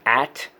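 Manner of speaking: at said alone, clearly; first syllable. Shown as a demonstration. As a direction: isolate the syllable at. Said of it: "Let's go first syllable".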